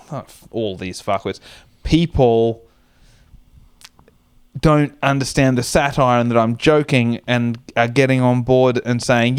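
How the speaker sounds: usual speaking voice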